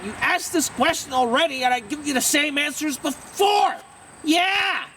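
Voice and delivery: high-pitched exclaiming, rising to a yelped end; shouty. Acted. Telling off someone who asked this question already, shouty. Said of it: "Why do you always shout?".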